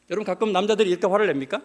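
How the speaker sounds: ordinary talk, nothing marked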